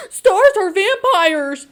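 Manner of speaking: high, loud, slow dramatic reading; dramatic gasp line. Retold or imitated. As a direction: proclaim it like shocking news.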